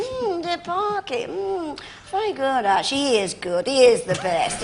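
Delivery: slow, drawn-out camp voice; slow camp drawl. Retold or imitated. Husband imitating Kenneth Williams asking about the show. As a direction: stretch it out, camp and languid. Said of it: "he'd say".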